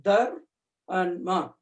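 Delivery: Dhar, pause, Ma, spelt out slowly; split into syllables. Shown as a demonstration. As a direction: break it into two syllables: Dhar... Ma. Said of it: "the first syllable and the last syllable".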